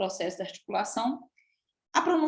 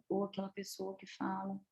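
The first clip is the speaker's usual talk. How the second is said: barely audible speaker; 11 dB quieter than usual. Shown as a demonstration. Coach showing students someone asked to speak up, very quiet. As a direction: drop the volume until it is hard to hear. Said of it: "pede pra falar mais alto".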